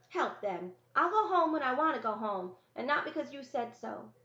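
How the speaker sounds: put-on high, loud stubborn child's voice; a small boy digging his heels in; defiant little brother. Brother defies his sister's order, high and loud.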